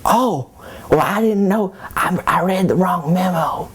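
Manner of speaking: high innocent voice, pretending not to know; high-pitched playing dumb. Joseph fibbing to Mary about a memo, high and innocent. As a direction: sound sheepish and naive.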